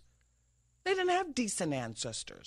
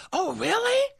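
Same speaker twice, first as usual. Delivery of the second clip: higher mock amazement; mock impressed; 9 semitones higher, 4 dB louder than usual. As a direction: gush like a starstruck fan.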